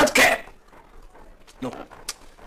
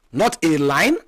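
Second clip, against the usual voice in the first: anguished emphasis; 7 dB louder than usual. Acted. Father grieving missing son.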